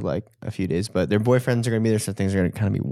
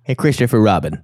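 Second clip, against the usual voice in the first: Australian accent; 6 dB louder than usual. Shown as a demonstration. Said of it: "my Australian accent".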